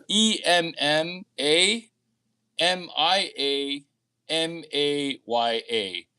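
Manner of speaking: slow letter-by-letter spelling. Shown as a demonstration. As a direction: spell each letter out slowly. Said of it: "It's spelled".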